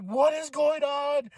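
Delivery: high, slow cry of disbelief; retold stunned outburst; baffled fan exclamation. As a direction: stretch it out high in astonishment. Retells crowd's baffled shock.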